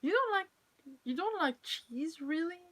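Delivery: high, exaggerated mock disbelief; mock shock. Teasing a viewer who dislikes cheese, mock shock. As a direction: gasp it out in playful shock.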